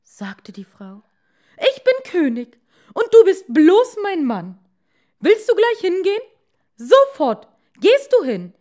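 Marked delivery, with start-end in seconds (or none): 1.6-8.5 much higher and louder furious scream; hammed-up raging wife, fast; furious shout. A narrator's reading. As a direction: scream at him like an enraged queen. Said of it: "furious queen wife".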